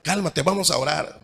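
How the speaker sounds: soft, hushed calming words; soothing, calming. Retold or imitated. Hugging an angry brother, urging him softly to calm down. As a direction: gently talk an angry brother down. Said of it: "tú vas y lo abrazas y le dices".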